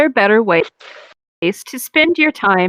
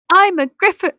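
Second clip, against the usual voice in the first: mock character voice; 9 semitones higher than usual. Reading a character's line aloud.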